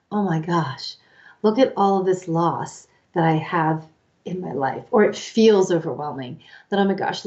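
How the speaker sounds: normal talking voice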